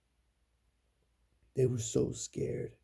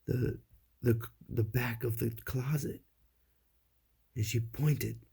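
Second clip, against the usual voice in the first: plain reading voice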